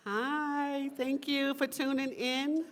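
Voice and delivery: greeting online viewers through the camera, lifted and eager; bright camera greeting. Real, not acted. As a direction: wave hello to the Zoom viewers. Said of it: "That camera right over there".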